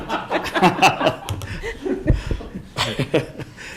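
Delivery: airy laughter rolling on; breathy group laughter. Real, not acted. Council room laughing at the mayor's joke, breathy. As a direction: let the laugh run out breathy.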